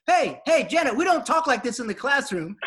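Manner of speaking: high, loud classroom reprimand; imitated teacher telling a student off; scolding coach. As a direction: call a student out sharply across the room. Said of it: "And then Coach Kitts goes".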